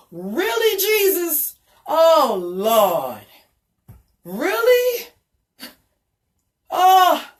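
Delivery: high, loud cry of disbelief to Jesus; exasperated outcry. Real, not acted. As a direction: shout it up at heaven, half laughing in despair.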